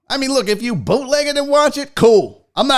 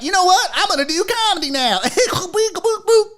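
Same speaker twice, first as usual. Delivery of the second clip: high, giggly mock comic with silly boops; higher, laughing send-up of a bad comedian; mocking wannabe comic; 9 semitones higher than usual. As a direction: parody a clueless celebrity doing stand-up. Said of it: "the equivalent of them being like".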